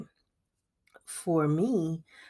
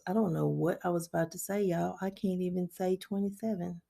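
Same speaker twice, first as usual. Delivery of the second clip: ordinary talk, nothing marked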